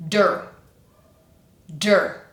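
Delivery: "Der" with a proper hard d; isolated, firm "Der"; exaggerated D. Shown as a demonstration. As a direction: say "Der" as d straight into er. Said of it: "treat it like a proper D sound".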